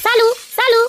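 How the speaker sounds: searching call, name repeated. Acted. Husband searching the house for his wife, calling out.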